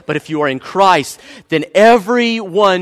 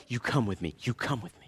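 much softer and lower, gentle; tender reassurance; 8 semitones lower, 16 dB quieter than usual. Acted. God answering a hurting runner, soft and tender. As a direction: comfort them close and quietly.

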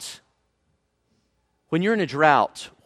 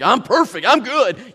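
higher, louder mock confidence; cocky self-assurance; 6 semitones higher, 6 dB louder than usual. Preacher mimicking someone who always felt righteous, mock cocky. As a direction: toss it out as a breezy self-satisfied boast. Voicing a self-satisfied person.

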